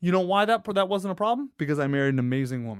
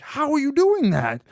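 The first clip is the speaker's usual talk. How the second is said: higher, louder incredulous question; mock amazement; 11 semitones higher, 5 dB louder than usual. Friends ask the rested new dad his secret, incredulous. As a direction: imitate a baffled friend who can't believe it.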